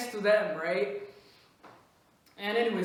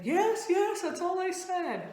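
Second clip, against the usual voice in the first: much higher, hurried-to-reassure voice; scared friend insisting; 8 semitones higher than usual. Retold or imitated. Friend denying she said more, high and insistent. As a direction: insist defensively, pitched high. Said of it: "she was scared".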